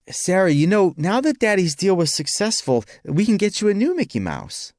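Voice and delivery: put-on high-pitched talk to a small child; coaxing father. A narrator's reading. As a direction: coax a little girl with a promise. Father coaxes small daughter.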